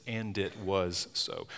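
"and it was so" slowed, each word weighted; slow emphatic "and it was so". Shown as a demonstration. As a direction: drag out "and it was so". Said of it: "He said, he spoke this".

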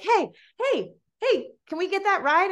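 put-on high-pitched voice of girls hailing someone; imitated calling out. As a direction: call after someone, high and eager.

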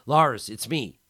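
anxious friend at the penthouse door; calling through door. A narrator's reading. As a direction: call through the door while pounding. Pounding on the door.

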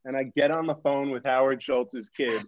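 normal talking voice

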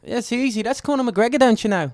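high, loud put-on Irish accent. Shown as a demonstration. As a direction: do a Conor McGregor-style Irish brogue.

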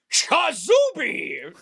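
long, high-swooping spell shout; exuberant incantation. Acted. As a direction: belt out the magic word, drawn way out. Wizard casts spell with a flourish.

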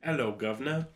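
light attempt at a British greeting; casual stab at British; British accent. Shown as a demonstration. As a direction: try a cheeky British accent. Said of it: "do your best British accent".

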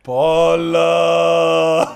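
loud drawn-out call. Retold or imitated. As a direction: holler the name across the yard. Drunk neighbor yelling for wife.

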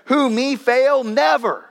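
mock denier scoffing at failure; mock indignant denial. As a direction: play the one who can't admit failure. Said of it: "the person who says".

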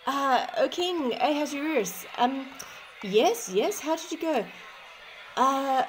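high, hesitant servant voice; timid messenger stammering bad news; hunchback assistant. Messenger reports back to king.

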